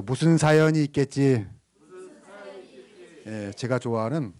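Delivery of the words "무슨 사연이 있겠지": projected, evenly held call to echo; louder congregational prompt. Shown as a demonstration. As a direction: say it out big so the crowd repeats it. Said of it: "야, 좀 크게 해보세요".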